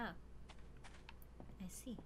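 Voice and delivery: whispering. Real, not acted. Whispers realization.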